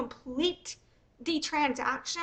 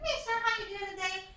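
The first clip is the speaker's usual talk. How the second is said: higher, soft, sing-song canvasser patter; fundraiser street pitch; 3 semitones higher, 7 dB quieter than usual. Retold or imitated. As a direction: sweet-talk a stranger into stopping. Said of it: "I'm gonna be like".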